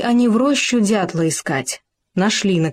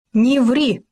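sharp, higher-pitched reprimand; understated indignant cry; mild shout; 7 semitones higher than usual. A narrator's reading. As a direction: raise the voice a little to scold. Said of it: "прикрикнул Суслик".

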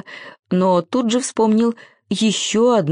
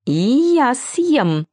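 high little squeaky voice; small, high, eager squeak; 7 semitones higher, slower than usual. A narrator's reading. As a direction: pipe up from a corner. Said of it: "пискнул кто-то из-за угла".

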